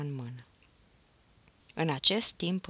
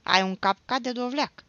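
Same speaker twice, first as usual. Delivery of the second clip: loud, higher-pitched sibling taunt; mocking, raised voice; 7 semitones higher, 10 dB louder than usual. A narrator's reading. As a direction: jeer at your brother. Sister taunts her brother.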